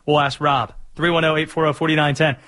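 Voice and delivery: ordinary talk, nothing marked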